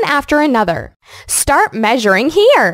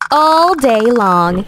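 normal talking voice

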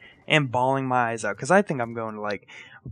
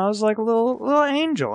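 sweet little angel; 9 semitones higher, faster than usual. Real, not acted. Calling himself a little angel.

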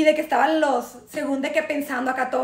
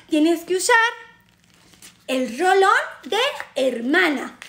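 mock ad read in a Castilian Spanish accent; put-on Spain accent. Pitching an eye roll-on to viewers, put-on Spain accent. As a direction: sell it with an exaggerated Spain accent. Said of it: "[name] está probando mi acento español".

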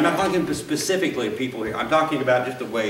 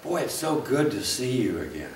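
soft, glad welcome back; the comforting take, said softer; 3 semitones lower, 7 dB quieter than usual. Shown as a demonstration. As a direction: greet them softly like you missed them. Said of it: "Instead of".

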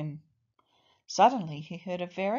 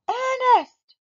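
put-on high, loud call for a child; mother's acted shout across a distance; 9 dB louder than usual. A narrator's reading. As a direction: call the name out loudly, pitched way up. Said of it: "called Mum".

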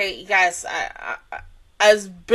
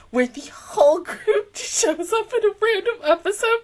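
teary whisper; 6 semitones higher than usual. Real, not acted. Fan overwhelmed, hoping group returns.

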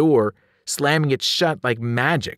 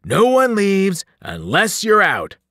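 slow, loud, raised bellow; roaring giant; 6 semitones higher, 5 dB louder than usual. A narrator's reading. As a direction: roar the rule at trapped prey. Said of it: "Joe Bob roared".

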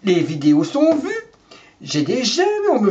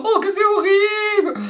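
hysterical maid on the phone; 12 semitones higher than usual. Acted. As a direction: scream down the phone in mock panic. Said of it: "elle a pas crié comme ça".

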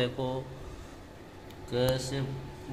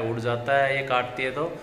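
ordinary talk, nothing marked

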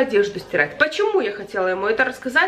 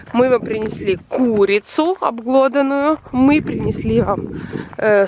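ordinary talk

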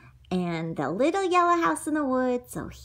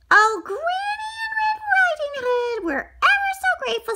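put-on squeaky, quick thankful girl; high grateful girl; 3 dB louder, faster than usual. Girl thanking the hunter, high and quick. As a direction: gush it out high like a small girl.

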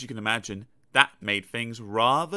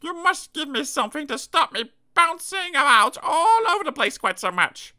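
small high character voice; 14 semitones higher, 5 dB louder than usual. Acted. Character pleading.

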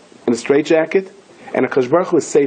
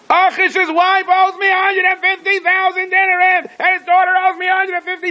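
mad screaming. Acted. David feigns madness banging at the king's door, screaming wildly. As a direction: scream it out like a raving madman. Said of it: "he's screaming. He goes".

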